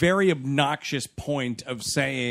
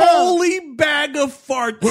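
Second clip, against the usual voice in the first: outburst; 12 semitones higher, 5 dB louder than usual. Real, not acted. Exclaiming at the director.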